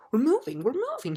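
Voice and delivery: high, loud delighted shout; happy cry. Acted. Milo thrilled the car moves again, high happy cry. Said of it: "he cried happily".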